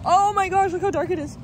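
surprised. Real, not acted. Dark clouds over them.

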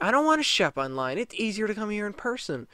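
mocking entitled whine; whiny Karen. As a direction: whine like a spoiled adult. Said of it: "The one was like".

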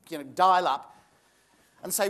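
ordinary talk, nothing marked

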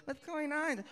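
put-on high, soft toddler voice; sleepy little boy asking; little kid. Three-year-old waking up, high kid voice. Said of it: "He's like".

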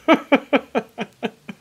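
high, loud burst of laughter; real amused laugh; hearty laugh. Real, not acted. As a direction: laugh it off at yourself. Laughs at own failed weld.

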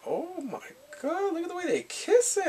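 high-pitched gushing amazement; scandalized delight. Retold or imitated. Fan reacting to the passionate kiss, high excited amazement. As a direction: gush high, stretching the oh my God.

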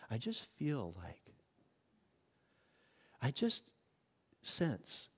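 hushed churchy imitation. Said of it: "Have you heard those?".

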